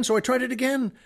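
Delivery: plain reading voice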